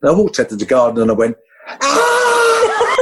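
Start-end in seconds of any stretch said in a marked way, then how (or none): 1.8-3.0 a wild high shout of joy breaking into a laugh; ecstatic yell. Retold or imitated. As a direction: let it all out alone in the garden, then laugh. Walked out to garden, went.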